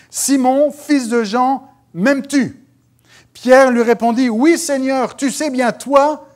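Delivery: high, loud declamation; scripture proclamation. Acted. Preacher reads Simon's answer to Jesus, loudly proclaimed. Reads Bible passage aloud.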